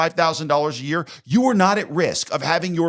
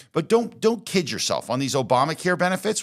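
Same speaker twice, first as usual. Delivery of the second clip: ordinary delivery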